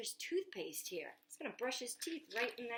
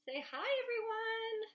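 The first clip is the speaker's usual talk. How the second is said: tortoise voice; 11 semitones higher than usual. Pretend. Tortoise greeting the children.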